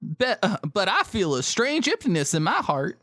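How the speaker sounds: high, put-on Southern belle; Southern accent. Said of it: "I can do this in an accent".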